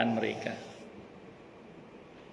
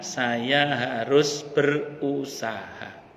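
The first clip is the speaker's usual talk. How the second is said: very slow, syllable by syllable; deliberate and drawn out, each word weighed; slow resolve; 3 dB quieter, much slower than usual. Retold or imitated. Preacher voices Hajar's faith to the congregation, slow resolve.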